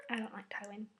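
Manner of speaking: unvoiced confiding; whispered aside. Real, not acted. Telling viewers her dislike in a whisper.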